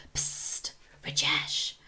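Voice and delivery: voiceless hissed whisper; boy whispering to Rajesh across the table. A narrator's reading. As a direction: whisper it so the teacher won't hear. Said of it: "whispered a boy's voice".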